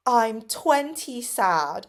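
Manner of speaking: put-on gleeful kid announcing sadness, slow and loud; happy tone. Pupil answering "how are you", happy tone. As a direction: stretch it out high and loud, delighted. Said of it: "say it in the most, like, happy tone ever".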